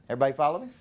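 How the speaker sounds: usual speaking voice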